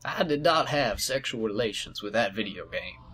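low, soft mock presidential denial; Bill Clinton. Host joking as the president about a video game, smooth impression. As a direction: do a hushed politician impression. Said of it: "I remember seeing Bill Clinton".

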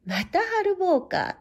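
a high, slow, bored sigh of a line; unimpressed onlooker, slow and high; weary dismissal. Acted. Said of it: "と言ったきりで".

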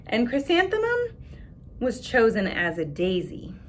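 put-on expressive read-aloud for children; story narration. A narrator's reading. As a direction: read the picture book to the class with voices. Reads picture book aloud.

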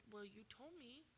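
the man on the mat, softly insisting he's trying; straining lame man. Acted. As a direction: murmur it as if pushing hard with nothing happening. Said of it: "suppose the man laying there said".

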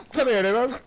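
normal talking voice

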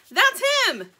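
high, loud shriek of recognition; screamed. A narrator's reading. Little bird spotting the thief in the sketch, screamed. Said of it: "screamed the little bird".

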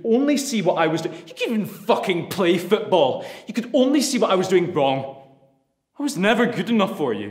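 put-on bitter shouting at the old man; angry shouting. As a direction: hurl it at your father. Angry son confronting his father.

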